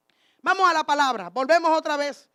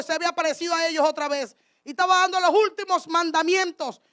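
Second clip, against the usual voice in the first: normal talking voice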